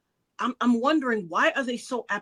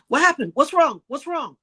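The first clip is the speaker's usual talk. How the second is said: higher-pitched retold alarm; instant alarm; 5 semitones higher, 6 dB louder than usual. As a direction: imitate a sister suddenly worried, questions coming at once. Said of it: "she just instantly said".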